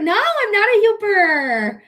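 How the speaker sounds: high, drawn-out playful denial; mock protest. Streamer denying a viewer's guess about her, mock protest. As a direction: wail it in playful indignation.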